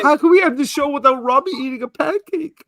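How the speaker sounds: mock distraught. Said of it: "I'm distraught".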